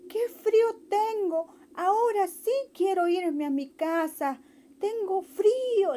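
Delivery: high, whiny bunny voice; a soaked rabbit wanting to go home; wet shivering rabbit. Acted. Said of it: "y decía". (conejito mojado, voz aguda y quejosa)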